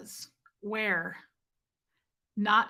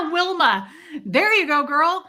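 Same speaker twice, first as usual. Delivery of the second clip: loud, higher cheering for a friend; excited cheer; 6 semitones higher, 9 dB louder than usual. Real, not acted. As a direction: hype her up loudly. Cheering a chat member.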